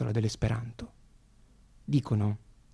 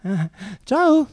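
saluto squillante; 7 semitones higher, 9 dB louder than usual. Retold or imitated. Saluto dell'attrice che scende.

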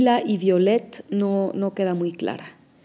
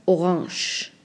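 French-style orange; 2 semitones lower than usual. Shown as a demonstration. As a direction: say orange like a French word, nasal end. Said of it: "lo afrancesan mucho y pronuncian".

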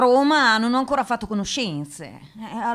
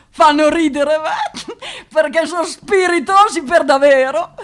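laughing; 5 semitones higher, 4 dB louder than usual. Real, not acted. Laughs about Roman boys.

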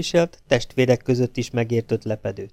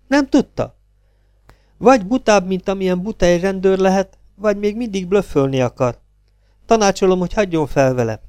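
put-on higher, scornful young woman's voice; mocking young woman; 6 semitones higher than usual. A narrator's reading. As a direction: taunt the cop from above. Gina mocks the detective. (gúnyos, magasabb női hang)

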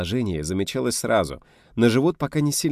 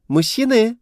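high loud ringing shout; ringing call; 8 dB louder than usual. A narrator's reading. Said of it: "звонко завелся голос Лизаветы".

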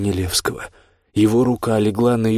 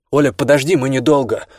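called out, higher and louder; calling out; 5 semitones higher, 6 dB louder than usual. Acted. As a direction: shout after her. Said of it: "крикнул Данилевский".

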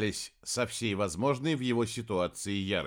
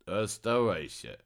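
low, slow, droning mumble; 4 semitones lower than usual. A narrator's reading. Стрек tells Хелений to stay behind, droning mumble. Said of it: "пробубнил тот".

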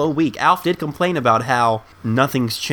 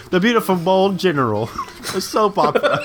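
laughing through the words; giggling as you repeat it; 6 semitones higher than usual. Real, not acted.

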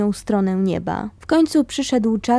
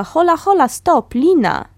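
raised, higher call to halt; 6 semitones higher, 5 dB louder than usual. Retold or imitated. Stefan calls to the narrator to stop, loud call. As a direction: shout him back before he swims off. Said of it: "Stefan woła".

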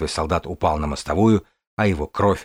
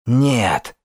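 hoarse cry; 4 semitones higher than usual. A narrator's reading. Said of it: "крикнул Кирин хриплым, сорванным голосом".

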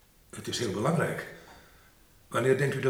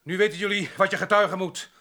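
loud public declaration; 10 semitones higher, 4 dB louder than usual. Acted. André confesses to the whole party.

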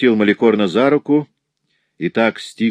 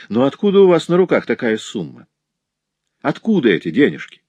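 higher-pitched, brazen questioning; insolent rudeness; 6 semitones higher, 3 dB louder than usual. A narrator's reading. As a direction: demand an answer with rising cheek. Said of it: "возвращалась его грубость. Он нагло уставился". (нагло и грубовато, повыше)

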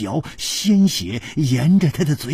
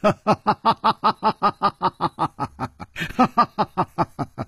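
laughter; 7 semitones higher than usual. Acted. Laughter after the shout.

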